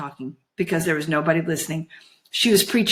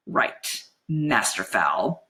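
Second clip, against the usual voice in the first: low, slow and drawn out; relishing the coming payback; grinning menace; 8 semitones lower, 3 dB quieter, much slower than usual. A narrator's reading. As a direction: savour the name with a grin. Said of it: "she grinned".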